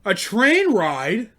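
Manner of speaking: slow, loud disbelieving echo; incredulous. Real, not acted. Comic to the audience, repeating dad's plan in disbelief. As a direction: drag it out in disbelief.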